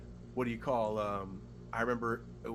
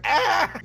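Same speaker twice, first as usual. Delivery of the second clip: sharp high startled cry; startled yelp; 12 dB louder than usual. Retold or imitated. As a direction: jolt out a quick high yelp. Cop startled, retold.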